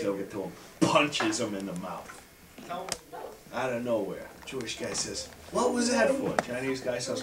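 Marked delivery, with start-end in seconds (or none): 5.5-6.4 high, startled question at no extra volume; mildly aggrieved, pitch jumping up; bewildered protest. Acted. Joke teller voicing a man punched in a bar, high and bewildered.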